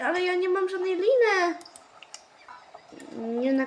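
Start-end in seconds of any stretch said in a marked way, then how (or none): 0.1-1.5 high, whiny complaint; whiny protest. Real, not acted. Player missing the rope she needs, whiny protest. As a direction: whine it with a laugh.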